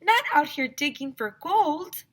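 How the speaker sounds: put-on high, prim girl's voice; Andrea's voice. A narrator's reading. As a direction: sound scandalised and proper. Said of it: "Andrea said".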